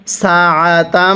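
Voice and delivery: সাআতাম with the doubled m hummed through the nose; ghunnah on mim. Shown as a demonstration. Teacher shows ghunnah on the mim, slow recitation. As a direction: hum the মিম of সাআতাম. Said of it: "কিভাবে শিখব?".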